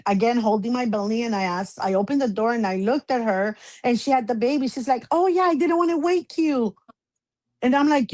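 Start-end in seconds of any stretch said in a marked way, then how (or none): 5.1-6.8 breezy high imitation of the relative; airy offhand mimicry; casual mock voice. Mocking the intruder's casual voice.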